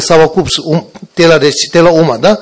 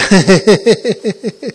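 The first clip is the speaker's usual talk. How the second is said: laughing; 3 semitones higher than usual. Real, not acted. Teacher laughs at own Russian.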